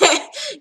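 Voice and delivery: high burst of laughter. Real, not acted. Laughs at own joke.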